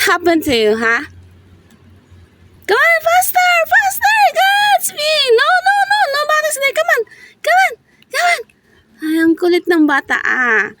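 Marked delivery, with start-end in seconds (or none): 2.7-8.4 high-pitched urging, faster. Real, not acted. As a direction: hurry the dog along up high. Urging dog to hurry.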